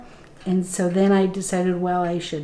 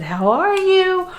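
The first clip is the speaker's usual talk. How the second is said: much higher, slow and tender question; gentle concern; 9 semitones higher, slower than usual. Retold or imitated. Gently waking her after healing.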